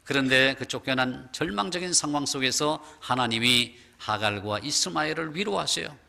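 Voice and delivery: ordinary talk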